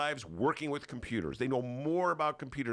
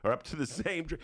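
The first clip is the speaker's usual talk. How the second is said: ordinary talk, nothing marked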